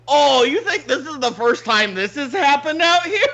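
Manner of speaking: high, laughing mock incredulity; imitated laughing. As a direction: play amused security laughing through the line. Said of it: "and they're fucking laughing".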